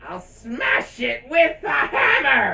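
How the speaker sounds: slow high shout. Retold or imitated. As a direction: yell it with slow fury. Quoting Kuzco's shout.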